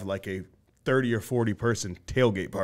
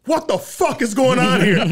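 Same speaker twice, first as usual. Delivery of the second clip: mock angry yell; 10 semitones higher, 6 dB louder than usual. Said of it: "he's had enough".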